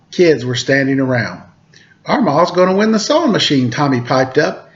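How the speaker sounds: lively read-aloud storytelling voice; storyteller reading a picture book to kids; story narration. A narrator's reading. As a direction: read it to children, bright and clear.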